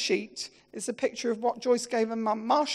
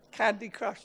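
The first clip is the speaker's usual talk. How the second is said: ordinary talk, nothing marked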